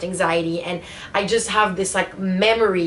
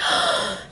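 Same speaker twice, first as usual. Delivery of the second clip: sharp, sudden gasp of fear; frightened gasp; 3 dB quieter than usual. Retold or imitated. Passenger as the plane turns, retold frightened gasp. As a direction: imitate a startled intake of breath. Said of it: "every time the plane would turn".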